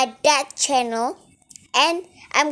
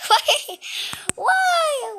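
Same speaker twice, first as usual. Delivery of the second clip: high squeal of why through laughter; laughing at a silly ragdoll fall; 13 semitones higher than usual. Real, not acted. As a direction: giggle the question out, pitched way up. Laughing at ragdoll game glitch.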